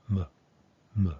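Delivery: "m" as a tiny "muh" with no definition; reduced, real short. Shown as a demonstration. Teacher shows the reduced first syllable, "m" real short. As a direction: cut "m" to a bare schwa, no tone. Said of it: "Real short, no definition".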